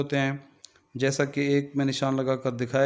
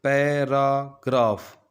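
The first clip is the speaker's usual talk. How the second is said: Paragraph slowly in three parts, पै, रा, graph; slow teacher's syllable count on paragraph; much slower than usual. Shown as a demonstration. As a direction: break paragraph into पै - रा - graph with pauses. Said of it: "तीन syllable पर मुश्तमिल है".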